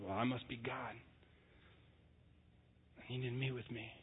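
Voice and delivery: smug hushed self-talk. Retold or imitated. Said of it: "we're like".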